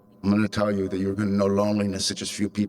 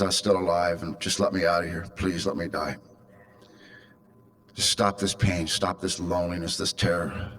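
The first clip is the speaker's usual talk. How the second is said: soft, hollow, hopeless begging; a quiet retold prayer to die; desperate plea; 5 semitones lower, 3 dB quieter than usual. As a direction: let the plea fade into softer despair. Despairing morning plea, retold.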